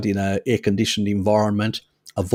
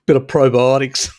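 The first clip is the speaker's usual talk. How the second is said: laughing; 4 semitones higher, 4 dB louder than usual. Real, not acted. Joking with chat, laughing.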